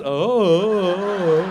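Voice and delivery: loud, long vacant "oh"; dazed teenager, imitated; spaced-out boy. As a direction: play the oblivious son. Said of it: "boys get a little spaced out".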